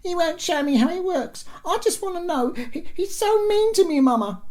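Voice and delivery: put-on very high, wailing little girl; crying small child. A narrator's reading. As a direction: wail to mama in a tiny squeaky voice. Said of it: "cried the small one".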